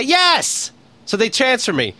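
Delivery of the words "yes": high snapped yes; exasperated yes. Retold or imitated. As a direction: snap at the machine. Yelling at phone menu.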